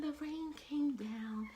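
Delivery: high, soft, slow falling rain; hushed storytelling. A narrator's reading. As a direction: drop suddenly to a light hush. Storm dies to rain.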